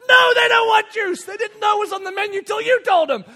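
loud, high comic outburst; exasperated yell. Retold or imitated. Dad to waiter offering juice, exasperated yelling. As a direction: explode at the server in mock exasperation. Dad snapping back at server.

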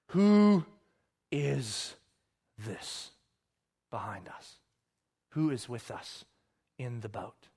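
slow, low, with long pauses; grave wonder, dropping to near silence; hushed, weighty question. Acted. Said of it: "They're asking a massive question".

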